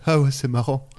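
low-key, understated imitation of his reply. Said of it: "J'ai calé une imitation".

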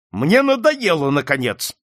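fed-up outburst, high and loud at first; angry cry. A narrator's reading. Detective is fed up with the client, angry. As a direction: burst out that you've had enough. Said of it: "гневно закричал сыщик".

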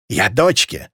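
ordinary delivery, nothing marked

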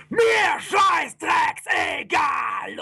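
loud, high, very drawn-out yell; furious roar. Acted. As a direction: bellow it, stretching every word. Demon cuts off the scientist.

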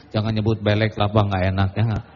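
usual speaking voice

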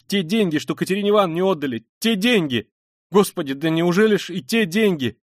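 loud, high, and fast on the first line; horrified exclaiming. A narrator's reading. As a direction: horror as it dawns on her. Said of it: "вскрикнула она вдруг".

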